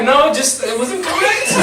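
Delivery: mild mock timid voice; the big guy meekly defending himself; meek protest. Big guy's timid reply.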